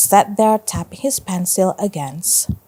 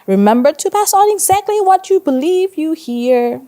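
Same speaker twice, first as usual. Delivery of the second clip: high, loud teacherly reminder; instructing teacher; 9 semitones higher, 5 dB louder than usual. A narrator's reading. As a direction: project a pointed reminder to the class. Teacher instructing class in story.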